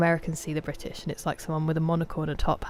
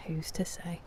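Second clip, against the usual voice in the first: much softer, almost to herself; hushed repeat; 10 dB quieter than usual. Shown as a demonstration. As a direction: drop it to a murmur. Repeats the question, second time hushed.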